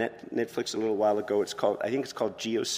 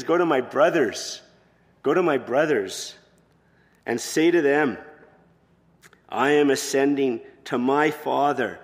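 fervent sincere quote; 3 semitones higher, 6 dB louder than usual. Acted. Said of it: "He says".

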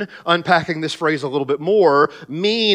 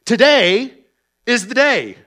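proclaiming; 5 semitones higher, 4 dB louder than usual. Acted. Preacher proclaiming the verse.

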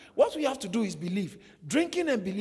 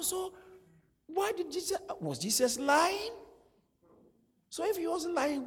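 high, sceptical mock-naive voice; lightly voiced naive churchgoer asking why no water; mock baffled believer; 11 semitones higher than usual. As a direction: play the puzzled doubter, pitched up. Mock puzzled skeptic.

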